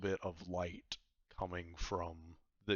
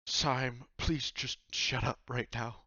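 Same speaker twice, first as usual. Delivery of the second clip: raised, higher, fed-up voice; exasperated plea; 5 semitones higher, 6 dB louder, slower than usual. Acted. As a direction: beg him loudly to stop talking. Upset Vaku silencing the cloak.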